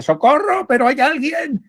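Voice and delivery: high, frantic call for help from a cliff edge; desperate high yell, hanging from a branch; panicked cry for help. Acted. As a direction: shout up from the precipice in panic. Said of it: "el catalán y dice".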